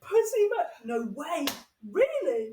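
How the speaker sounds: high and loud, blurting out in surprise; shocked disbelief. Real, not acted. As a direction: gasp it out like you can't believe it. Shocked reaction to virginity story.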